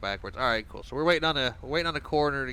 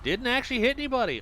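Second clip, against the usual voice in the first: amused surprise; 4 dB louder than usual. Real, not acted. Relieved surprise at traffic.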